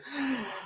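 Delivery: soft, sharp intake of breath; mock gasp. Reacting to Kim spilling Rob's secret, soft breathy gasp. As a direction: gasp as if scandalised. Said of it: "And it was just like".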